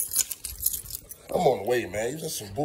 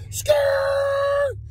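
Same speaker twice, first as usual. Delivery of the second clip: tire screech. Pretend. Tire screech while speeding off.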